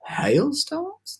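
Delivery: high scoffing question; disbelieving, mocking echo; incredulous. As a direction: centipede scoffing at James. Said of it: "the centipede said".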